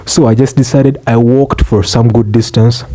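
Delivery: normal talking voice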